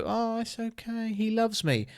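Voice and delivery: high, soft, cooing mock voice; doting snake owner. Imagined snake cuddler reassuring everyone about the pet, mock doting. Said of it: "cuddle a snake in bed and go".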